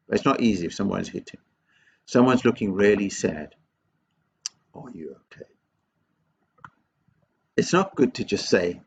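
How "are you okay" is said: very soft, low, caring question; from the heart. Shown as a demonstration. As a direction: check on them tenderly, almost under your breath. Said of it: "you just say from your heart".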